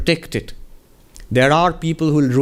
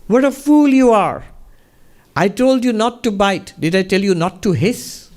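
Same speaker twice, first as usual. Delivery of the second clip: much higher and louder mock scolding; guru's high, loud, half-laughing rebuke; 8 semitones higher, 3 dB louder than usual. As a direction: scold the foolish snake in a raised fond voice. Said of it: "he said".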